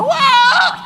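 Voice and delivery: high mock scream of fright; imitated shriek of a startled housewife; woman's scream.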